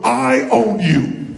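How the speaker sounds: loud, drawn-out playful challenge; emphatic challenge. Shown as a demonstration. Coach models a challenge for the audience to copy, emphatic. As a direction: stretch each word out and project it. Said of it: "point at them and go".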